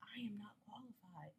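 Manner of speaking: whispered, defeated aside; deflated whisper. Retold or imitated. Job seeker reading demanding applications, whispering defeat. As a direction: breathe it out like giving up. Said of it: "I'm like".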